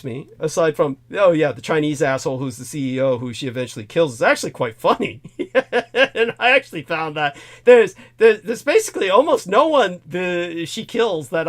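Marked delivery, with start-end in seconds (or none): 5.2-6.1 short laugh. Real, not acted. Laughs at the CEO being funny.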